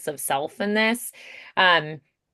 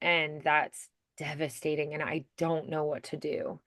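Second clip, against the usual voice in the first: soft, sad, sinking into helplessness; quietly devastated, letting the voice fall; deeply saddened; 4 semitones lower, 9 dB quieter than usual. Shown as a demonstration. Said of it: "really deeply saddened".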